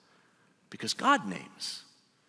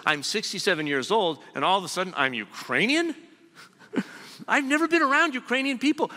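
higher, disbelieving outburst, rushing the end; incredulous Eddie; 9 semitones higher, 5 dB louder than usual. Retold or imitated. Preacher retelling an old man's disbelief at his roots, incredulous. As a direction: act out an old man stunned by news. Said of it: "he said".

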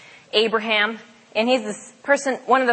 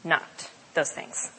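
hushed; 5 semitones lower, 8 dB quieter, slower than usual. Acted.

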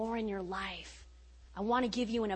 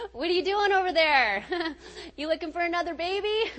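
laughing; 7 semitones higher, 3 dB louder than usual. Retold or imitated. Joking with doctor during ultrasound.

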